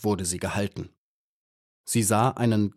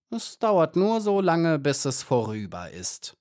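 high start sliding down, falsely soothing; insufferable friendliness; 6 semitones higher than usual. A narrator's reading. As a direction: reassure your victim with sickly kindness. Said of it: "mit einer ganz unausstehlichen Freundlichkeit". (scheinheilig freundlich, böse)

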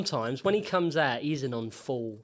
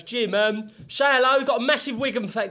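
excited mate; 7 semitones higher, 8 dB louder than usual. Retold or imitated. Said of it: "He goes".

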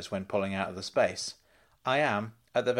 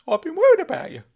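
put-on much higher, loud excited old uncle voice; high, loud eager uncle; Uncle Claude calling out; 10 dB louder, faster than usual. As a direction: squeal his name like a giddy fussing uncle.

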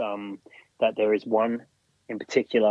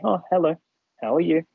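bright, higher, sing-song hello to the air; sing-song spirit greeting; 7 semitones higher, faster than usual. Retold or imitated. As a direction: greet invisible guests sweetly, pitched up. Medium greeting unseen spirits.

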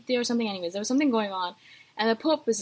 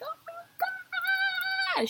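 very high drawn-out mock scream; slow high fangirl squeal; imitating screaming friend; 4 dB quieter, much slower than usual. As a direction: stretch it out like a screaming fan. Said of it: "She was like".

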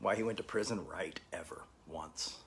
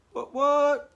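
excited high call-out; 11 dB louder than usual. Acted. Calling out to Belfast friends.

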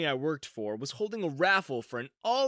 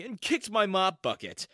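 ordinary delivery, nothing marked

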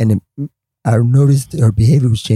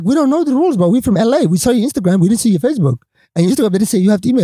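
pitched up, pleading, rushing at the end; high rushed plea; 9 semitones higher, faster than usual. Retold or imitated. Newcomer pleading with host Donovan, high rushed plea.

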